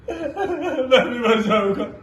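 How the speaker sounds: slow, soft tearful wail; acted sobbing, fading away slowly; sobbing breakdown. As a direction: break down and drag the words out through sobs. Same vow, breaking down.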